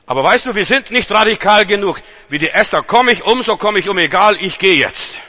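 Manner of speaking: rally the congregation, loud and high; fiery sermon cry; preaching at full force. Acted.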